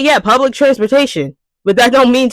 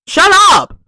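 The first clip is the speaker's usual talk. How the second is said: exasperated shout; 9 semitones higher than usual. Acted. Ranting at loud library patrons.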